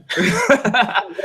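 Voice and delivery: quick high burst of laughter. Real, not acted. Laughing at lost thought.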